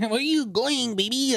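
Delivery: a lighter hammed-up repeat; mock jealous boyfriend. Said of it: "You know".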